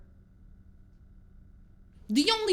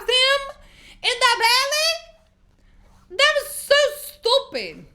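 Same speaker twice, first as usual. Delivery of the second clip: much higher, louder and slow, fed-up cry; exasperated outburst; 12 semitones higher, 5 dB louder, much slower than usual. Real, not acted. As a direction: stretch out the words in outraged disbelief. Ranting about a stupid movie scene.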